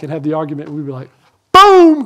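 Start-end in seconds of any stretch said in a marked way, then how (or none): 1.5-2.0 much higher, louder gloating burst; loud, high victory shout; triumphant boom. Retold or imitated. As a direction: slam it down like a winning point. Gloating after winning argument.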